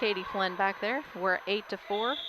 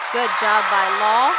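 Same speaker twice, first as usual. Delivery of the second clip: excited call; 12 dB louder than usual. Real, not acted. Play-by-play of a big kill.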